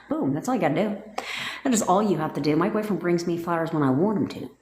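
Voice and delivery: ordinary talk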